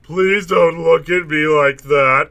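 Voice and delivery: plain reading voice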